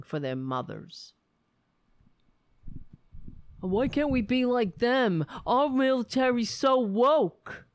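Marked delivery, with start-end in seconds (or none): 3.6-7.6 raised, louder, hammed-up sarcastic complaint; mock outrage. Host parodying right-wing pundits, loud sarcastic whine. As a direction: lampoon the pundits who envy the Russian army.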